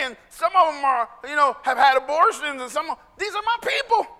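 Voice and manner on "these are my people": high, earnest voice of Jesus; voicing Jesus. Pastor voices Jesus welcoming sinners, high and earnest. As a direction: claim them as yours with conviction. Repeats Jesus's line emphatically.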